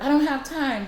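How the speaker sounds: put-on busy mother cutting her kid off; exasperated mom. Mom refusing her son a treat, exasperated.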